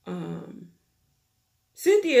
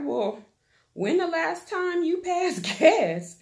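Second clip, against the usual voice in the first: lightly voiced sassy sister, pitched up; high mock sister voice; 10 semitones higher than usual. Sister teasing her sibling, high sassy mock voice. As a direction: tease her like a nosy big sister.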